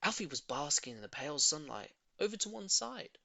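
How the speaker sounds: ordinary delivery, nothing marked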